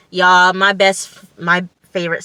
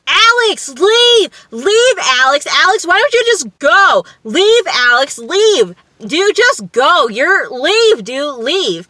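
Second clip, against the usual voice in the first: loud, very high-pitched mock shouting; hammed-up shrieked pleas to go; imitated yelling; 10 semitones higher, 6 dB louder, slower than usual. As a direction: yell it like a whole group telling someone to get out. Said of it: "It's a yelling match".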